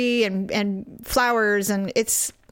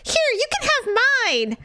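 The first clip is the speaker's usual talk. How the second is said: mimicking the shopper; 13 semitones higher, faster than usual. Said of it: "she's like".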